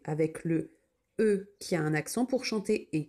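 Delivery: normal talking voice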